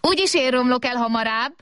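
put-on high, held, childlike boast; little robot's cry. A narrator's reading. Small robot outdoing the big one, high cry. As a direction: cry it out high, defiantly, without much volume. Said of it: "kiáltott a kis robot".

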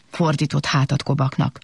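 plain reading voice

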